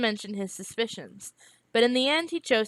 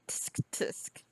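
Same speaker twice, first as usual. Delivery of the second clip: whispered mocking tongue clicks; quiet mocking tut-tut; mocking tsk; 12 dB quieter than usual. A narrator's reading. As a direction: tut softly at his slip. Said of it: "Rosethorn said mockingly".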